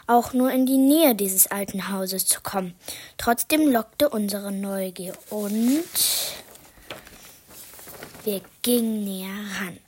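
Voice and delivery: hushed, secretive storyteller whisper; whispered narration. A narrator's reading. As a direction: lean in and whisper the spooky part.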